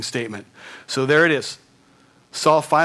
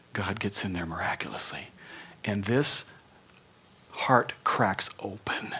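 hushed whisper; 5 semitones lower, 7 dB quieter than usual. Real, not acted. Hushed awe at a miracle.

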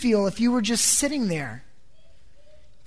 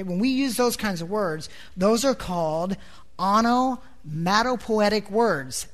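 normal talking voice

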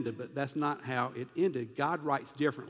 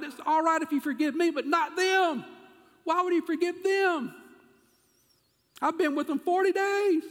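much higher and louder, an indignant protest building to outrage; angry Jonah; 11 semitones higher, 5 dB louder than usual. Acted. Jonah complaining to God about forgiving Nineveh, high furious outcry. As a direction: rail at God like a prophet who can't stand the mercy. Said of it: "it's like Jonah is saying".

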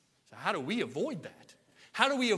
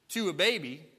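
usual speaking voice

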